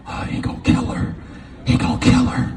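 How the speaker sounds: unvoiced, hushed dread; fearful whisper. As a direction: whisper like a scared kid watching. Kids whispering, watching sister.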